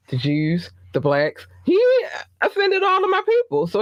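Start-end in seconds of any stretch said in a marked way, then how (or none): 1.6-3.7 indignant high voice; outraged pitch jump. Real, not acted. Repeating the outrage, higher.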